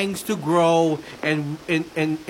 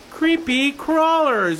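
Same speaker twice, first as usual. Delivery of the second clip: creepy high voice; 8 semitones higher, slower than usual. Pretend. Spooky emphasis on item.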